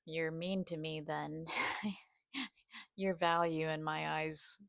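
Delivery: normal talking voice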